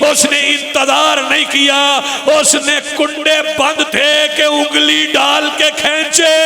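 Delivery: very high, very loud, slow chanted cry; wailing lament. Acted. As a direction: wail out the princess's pain to the crowd. (ऊँची, धीमी, विलाप भरी पुकार)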